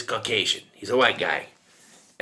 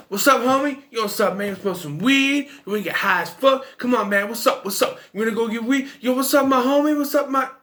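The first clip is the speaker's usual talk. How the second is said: much higher, hammed-up slangy street-talk impression; 8 semitones higher than usual. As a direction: overdo the laid-back homie lingo, pitched way up. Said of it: "acts, you know".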